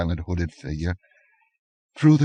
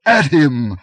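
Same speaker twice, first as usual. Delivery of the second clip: higher, much louder command; urgent order; 8 semitones higher, 10 dB louder than usual. A narrator's reading. Aquilonian to his men, loud order. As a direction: urge them forward. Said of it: "muttered the Aquilonian".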